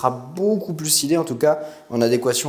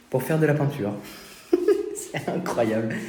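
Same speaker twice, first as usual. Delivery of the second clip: sucking a lollipop; 3 dB quieter than usual. Real, not acted. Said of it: "avec une sucette dans la bouche".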